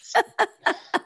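high giddy laugh; giddy squealing laugh. Real, not acted. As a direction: laugh like a thrilled fan. Gushing over her idol.

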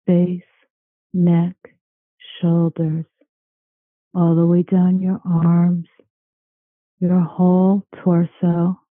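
slow, calm meditation voice; guided meditation. Acted. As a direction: guide them into calm, slowly. Leads a guided relaxation.

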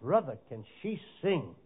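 ordinary talk, nothing marked

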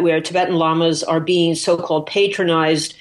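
ordinary delivery

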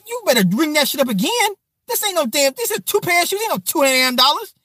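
high, disbelieving protest; indignant mother. Retold or imitated. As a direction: imitate mom disputing the bill. Mother arguing with cashier over price.

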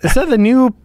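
curious dopey sea cow; manatee voice. Acted. Voicing manatees on the beach.

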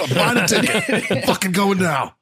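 high, laughing fake fury; laughing mock outrage. Laughing to friends, pretending to rush to Baltimore.

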